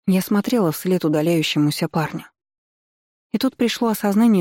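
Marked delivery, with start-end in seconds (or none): none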